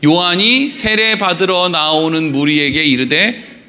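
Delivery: low, measured Bible reading; solemn congregational reading pace; scripture reading. Acted. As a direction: read the verses out evenly, in a low voice. Reads scripture aloud with congregation.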